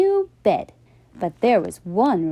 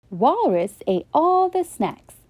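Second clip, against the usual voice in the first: usual speaking voice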